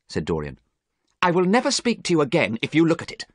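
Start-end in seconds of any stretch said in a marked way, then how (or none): 1.2-3.3 higher, agitated ultimatum; acted heated threat; threatening. A narrator's reading. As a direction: warn him off urgently. Dorian threatens Basil in quarrel.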